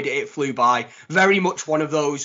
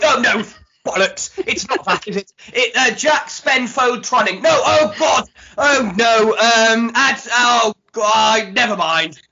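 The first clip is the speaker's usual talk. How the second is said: higher, louder hammed-up posh voice; posh Jack impression; 7 semitones higher, 6 dB louder, slower than usual. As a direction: play it grand and fumbling, all airs. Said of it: "Let me try again".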